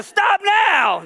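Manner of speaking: high, full-force order; commanding shout. Retold or imitated. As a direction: shout to break up a fight. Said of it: "if I spoke a certain way, it commanded attention".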